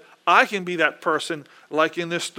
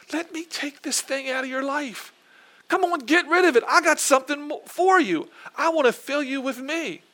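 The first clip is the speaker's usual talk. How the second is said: lightly raised, coaxing voice of the Lord; voicing the Lord; 7 semitones higher than usual. Preacher voicing the Lord urging his people to let go, coaxing. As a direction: gently urge the listener to let go. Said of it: "If the Lord's saying".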